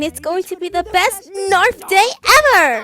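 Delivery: giddy excitement. Acted. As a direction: build to a thrilled shout. Thrilled about the birthday.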